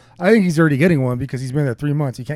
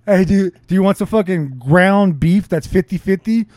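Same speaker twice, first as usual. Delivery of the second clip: mock pushy offer; 4 semitones higher, 6 dB louder than usual. Mock offer of bad beef.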